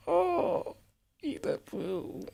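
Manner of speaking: squeaky-high mock rapture, slow; hammed-up giddy author; mock delight. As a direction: swoon over the line like a giddy author. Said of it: "Did he get a little chuckle with himself".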